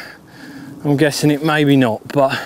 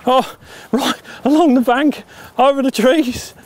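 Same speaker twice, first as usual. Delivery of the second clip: excited; 8 semitones higher than usual. Real, not acted. Pike running along the bank.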